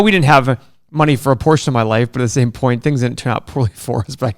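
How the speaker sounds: ordinary delivery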